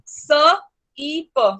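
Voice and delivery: स ई प drawn out slowly, sound by sound; slow phonics sounding out. Shown as a demonstration. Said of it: "वो सिप ही बन रहा है". (स ई प को बहुत धीरे, एक-एक आवाज़ अलग करके बोलें)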